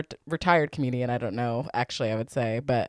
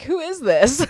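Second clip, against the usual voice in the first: mock confused caller; 10 semitones higher, 4 dB louder, slower than usual. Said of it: "So you were like".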